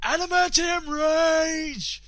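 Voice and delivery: slow, high, loud bellow; drawn-out battle cry. Pretend. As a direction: stretch it like a wrestling announcer. Shouts a battle-cry name.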